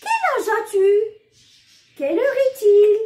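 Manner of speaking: asking tune set apart by pauses; question demo. Shown as a demonstration. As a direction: call out each question for the class. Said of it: "ce sont des phrases interrogatives".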